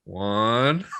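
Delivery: drawn-out "One" gliding upward; drunk echo. Retold or imitated. Drunk son echoing mom step by step, slurred mimicry. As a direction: imitate a drunk carefully copying the count. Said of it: "And I was like".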